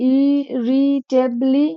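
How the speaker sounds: ইরিটেবলি drawn out slowly in separate syllables. Shown as a demonstration. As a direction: sound out ইরিটেবলি very slowly, piece by piece. Sounds out spelling by syllables.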